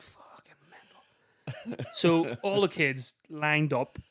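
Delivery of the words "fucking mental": whispered curse under the breath; stunned whisper. Retold or imitated. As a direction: breathe it out in shock, barely voiced. Retold stunned reaction, whispered.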